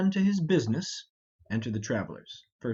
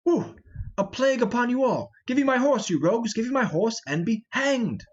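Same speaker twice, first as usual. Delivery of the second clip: angry shout; 5 semitones higher than usual. Acted. Falstaff yelling at the thieves for his horse, angry. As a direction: yell at the rogues who took the horse.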